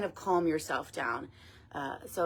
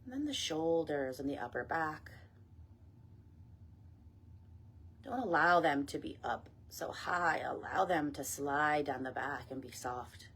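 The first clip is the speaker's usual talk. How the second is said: guided meditation; 6 dB quieter than usual. Acted. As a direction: ease them gently down, phrase by phrase. Leads meditation and breath work.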